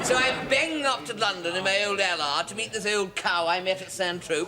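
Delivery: ordinary delivery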